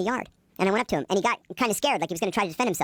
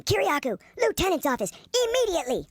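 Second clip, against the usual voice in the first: high barked summons; guard bellowing an order, pitched high; calling out; 10 semitones higher than usual. Retold or imitated. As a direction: call the name across the block. Guard calling out his name.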